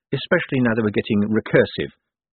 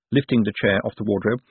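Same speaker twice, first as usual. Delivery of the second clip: ordinary delivery, nothing marked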